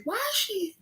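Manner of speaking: high, far-off disbelieving squeal; mock shocked high pitch; incredulous question. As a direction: ask it like you can't believe your eyes. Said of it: "I was like".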